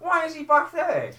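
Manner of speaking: loud, high, slow mock outcry; baffled mum. Man quoting his baffled mum at the cinema, loudly incredulous. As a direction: imitate a bewildered film-watcher calling out. Mimics mum's baffled outburst at film.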